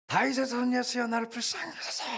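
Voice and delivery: high, loud desperate plea; crying out loud. Acted. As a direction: cry out to Jesus with all your strength. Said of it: "큰 소리로". (높고 큰 소리로 외치는 간청)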